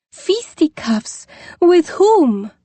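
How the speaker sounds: put-on high, slow, alarmed rolling foreign accent; horrified, drawn out in a rolling foreign accent; a scandalised Dowager in a rolling foreign accent. A narrator's reading. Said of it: "exclaimed in her rolling accents".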